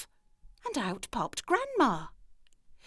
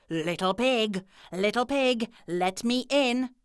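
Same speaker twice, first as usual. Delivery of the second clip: wolf's demand; 2 semitones higher, 3 dB louder than usual. A narrator's reading. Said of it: "The wolf shouted".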